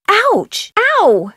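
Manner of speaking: sharp high yelps of pain; quick pained cries; sudden pain. Acted. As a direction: yelp as if just bitten. Hurt by the animal.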